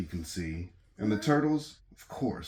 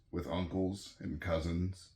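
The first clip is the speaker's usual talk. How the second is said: ordinary delivery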